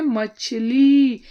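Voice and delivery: teacher revealing the answer word to the class; emphatic answer. Shown as a demonstration. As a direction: announce मछली slowly and firmly. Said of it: "ई, क्या होता है?".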